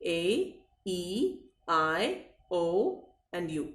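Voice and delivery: slow, each vowel drawn out; name each vowel slowly; listing vowels. Shown as a demonstration. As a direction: read out the five vowels, slowly. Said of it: "कौन कौन से हैं?".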